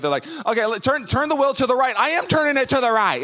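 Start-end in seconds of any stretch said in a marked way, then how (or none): 0.4-1.9 high, raised, exasperated directing; a heated back-and-forth over steering; acted frustration. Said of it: "you're starting to get frustrated". 2.0-3.2 high, loud exasperated retort; hammed-up exasperated shouting; frustrated yell. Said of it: "you're starting to get frustrated".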